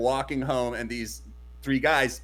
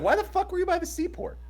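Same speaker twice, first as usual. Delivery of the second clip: mock incredulous. Friends' incredulous question retold.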